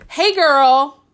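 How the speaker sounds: lightly put-on girlfriend-style greeting; gushy scripted greeting. Coach quoting a message script to avoid, mock chummy.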